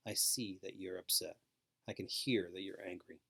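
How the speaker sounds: soft, low reassuring voice; calmly name his feelings; calm simple words. Shown as a demonstration. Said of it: "Use simple words".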